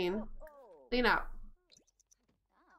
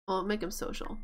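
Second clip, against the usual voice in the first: normal talking voice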